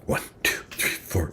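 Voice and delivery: winded, whispered counting; out-of-breath whispered counting after exertion; breathless whisper count. Shown as a demonstration. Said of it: "I'm breathing in between".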